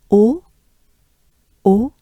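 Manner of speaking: O held round and loud; French O. Shown as a demonstration. Teacher drills the O vowel loudly. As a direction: round the lips for O. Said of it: "Torrent is".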